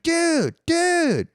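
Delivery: high, urgent friend voice; imitating a freaked-out buddy; alarmed friend. Friend catching him peeing in the closet, high alarmed call. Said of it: "He's like".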